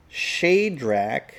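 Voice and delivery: Shadrach as SHAY-drack, slow and drawn out; chart's Shadrach. Shown as a demonstration. Teacher reading the chart's Shadrach from the verse. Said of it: "and it says".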